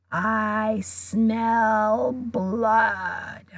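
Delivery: very slow, fuller murderous drawl; cold monster voice. A narrator's reading. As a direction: stretch it out, hungrier and stronger. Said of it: "same cold, murderous voice".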